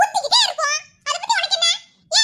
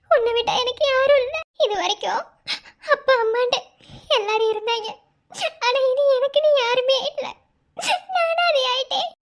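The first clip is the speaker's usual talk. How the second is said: tearful lament; 4 semitones lower than usual. Acted. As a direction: grieve as if all alone. அனாதை என்று அழுகிறாள். (கண்ணீருடன் புலம்பல்)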